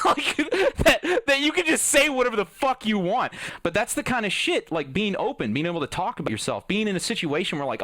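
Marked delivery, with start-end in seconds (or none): 0.0-3.3 laughing through the words, much higher and louder; cracking up while pushing the point out. Real, not acted. Laughing mid-rant about ridiculousness.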